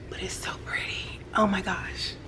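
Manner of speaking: soft whispered gushing; awed whisper. Real, not acted. Admiring the shimmer, a soft whisper. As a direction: whisper it in awe.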